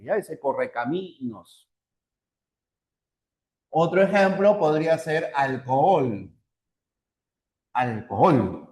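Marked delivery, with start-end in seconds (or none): 0.4-1.7 "correcaminos" with the "mi" lengthened and stressed. Shown as a demonstration. Teacher showing the written accent's syllable, stressed MI in "correcaminos". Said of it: "hay que conservarle la tilde". 7.8-8.7 "Alcohol" with weight on the final "hol"; stress on final syllable. Shown as a demonstration. Teacher giving another example of stress, final syllable stressed in "Alcohol". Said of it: "así, ¿no?".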